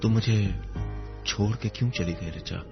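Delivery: ordinary delivery, nothing marked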